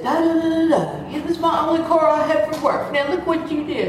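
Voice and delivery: high-pitched mock rant; imitating screaming man. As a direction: mimic an irate driver's high, rushed tirade. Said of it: "this man screaming at me".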